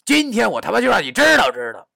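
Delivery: loud, high, threatening yell; full-voiced furious cry; big shout. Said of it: "我大喊道".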